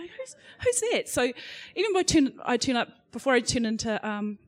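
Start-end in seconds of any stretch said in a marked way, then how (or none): 0.0-1.1 much higher, a playful mock double-take; mock puzzled. Panelist acting out her reply to the misnamed call, mock puzzled. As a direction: pitch it way up like a puzzled look-around. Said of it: "And I go".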